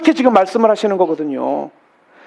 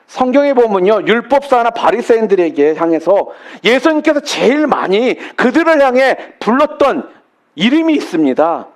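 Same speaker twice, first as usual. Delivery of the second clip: ordinary talk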